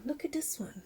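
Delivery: whisper. Real, not acted. Showing plant, whisper.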